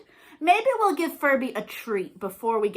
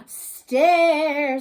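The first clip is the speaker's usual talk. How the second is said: zombie mommy; 5 semitones higher, 8 dB louder than usual. Acted. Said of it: "said the mommy".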